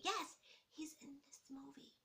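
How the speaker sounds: excited secret whisper; hushed confirmation. Real, not acted. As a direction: whisper it like juicy gossip. Excited whisper about the cast.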